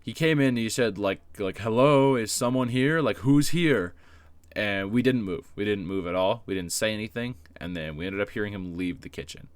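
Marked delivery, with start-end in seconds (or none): 1.6-3.9 a louder, higher call into the dark room. Retold or imitated. Band teacher calling into the dark kitchen, calling out loudly. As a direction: call out to find whoever is hiding.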